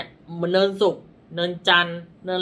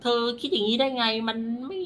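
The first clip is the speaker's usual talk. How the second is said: higher-pitched mock scolding; imitating society's nagging; 5 semitones higher than usual. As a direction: lightly act out critics piling on disapproval. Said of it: "ความกดดันของสังคม".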